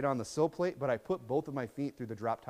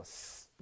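hissing; 18 dB quieter than usual. Retold or imitated. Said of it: "they go".

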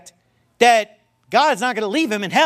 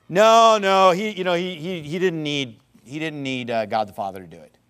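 mock objection; 5 semitones lower than usual. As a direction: imitate a hesitant objector, sinking to a mumble. Acting out an objector's reply.

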